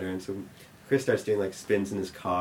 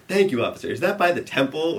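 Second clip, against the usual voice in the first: higher, louder, mock-innocent thanks; hammed-up innocence; 6 semitones higher, 7 dB louder than usual. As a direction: ham up the polite kid. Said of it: "playing this whole thing up".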